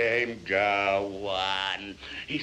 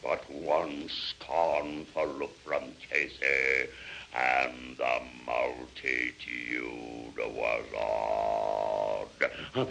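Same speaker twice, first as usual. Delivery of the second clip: much lower, slow and weighty; awed hush; 12 semitones lower, much slower than usual. A narrator's reading. Narrator showing the multitude silenced by Casey, low slow awe. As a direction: drop deep and draw it out. Casey's look hushes the crowd.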